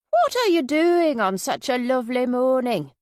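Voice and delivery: put-on high, loud Yorkshire accent; Minnie. A narrator's reading. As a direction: ask brightly in a lilting Yorkshire voice. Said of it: "Minnie's Yorkshire accent".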